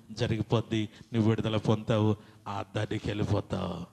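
usual speaking voice

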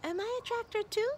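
put-on high, hopeful childlike question; hopeful little truckling. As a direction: ask it wishing the answer is yes. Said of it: "said the tractor".

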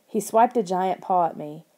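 usual speaking voice

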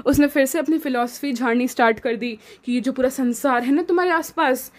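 usual speaking voice

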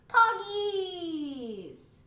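loud, high cry of "Poggies"; excited cheer. Real, not acted. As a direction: shout it out in delight. Cheering a new stream emote.

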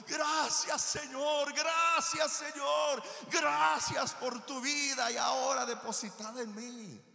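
loud, high cries of thanks; shouted thanks. Acted. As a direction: shout gratitude to the Lord, near tears. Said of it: "Está uno llorando".

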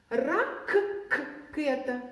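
Раккета with the к held long in the middle; doubled k. Shown as a demonstration. Teacher stretches к to show where it sits. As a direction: lean hard on the к of Раккета, slowly. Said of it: "Звук стоїть всередині слова".

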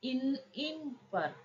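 इन drawn out slowly and softly; इन, इन पर spelled out slowly; sounding out in. Shown as a demonstration. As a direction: isolate the sound इन. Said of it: "जहां पर vowel लगना था".

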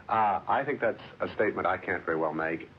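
ordinary delivery

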